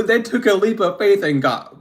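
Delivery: usual speaking voice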